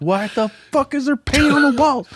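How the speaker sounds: high, exasperated retold shout; mock angry yell. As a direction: yell at the kid about the wall. Mom yelling at kid.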